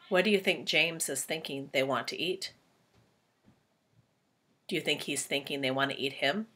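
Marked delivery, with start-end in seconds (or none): none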